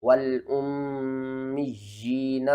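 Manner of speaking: والامیین very slow, the یا pulled long; stretched recitation. Shown as a demonstration. Teacher showing students the elongated یا, slow. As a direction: draw out the یا in والامیین like a chant. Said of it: "یا کو آپ نے نیچے کی طرف کھینچنا ہے".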